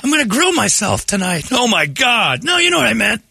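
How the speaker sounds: high, hammed-up flustered old guy; imitating Pop Pop. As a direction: quote him rising to a panicked yelp. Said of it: "Pop Pop's fun with grammar".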